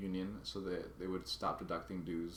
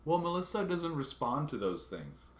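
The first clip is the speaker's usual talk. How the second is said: high, dismissive mimic of the clerk; mocking the union lady; 8 semitones higher, 5 dB louder than usual. Mimicking the bored union clerk's brush-off, high and dismissive. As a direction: brush it off in a prissy, raised voice. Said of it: "And she says".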